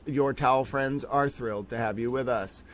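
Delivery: ordinary talk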